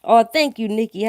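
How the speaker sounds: normal talking voice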